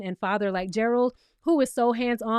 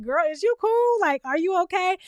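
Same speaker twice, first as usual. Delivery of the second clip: mock concern; 5 semitones higher than usual. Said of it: "looking at Britt like".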